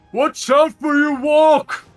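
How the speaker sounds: huge, high booming yell; dopey cartoon bellow. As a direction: bellow it like a dim giant. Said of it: "a booming boy, voice".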